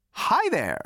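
a high, chirpy machine hello; bright computer greeting. A narrator's reading. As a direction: greet him like a cheerful computer. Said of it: "said the computer brightly".

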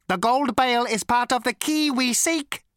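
high, loud, mechanical robot voice; cold, lifeless robot. A narrator's reading. Robot confronts Zaphod about his gun, cold and lifeless. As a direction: ancient robot with a cultured rasp, pitched high. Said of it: "cold, sleek, and lifeless".

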